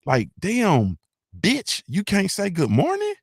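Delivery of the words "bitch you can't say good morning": high, drawn-out disbelief; mock outrage, pitch climbing; incredulous. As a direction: throw it back at her as an appalled question. Acts appalled reply to her line.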